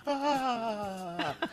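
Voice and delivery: fine woman's laugh. Acted. Radio host mimicking how fine women laugh, mock head-back laugh. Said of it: "When fi-, fine women, they laugh".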